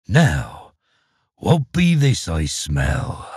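deep, slow suspicious ogre growl; a low sniffing threat from the ogre. A narrator's reading.

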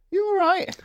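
very high squeaky question; put-on teasing squeak; imitated mocking sheriff. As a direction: pipe it up in a silly high voice.

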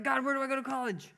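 high, quick mock plea to God; imitated pleading seeker. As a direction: act out someone begging heaven for answers. Voices a person asking God.

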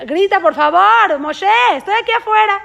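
calling out. Acted. Brother calling out at office.

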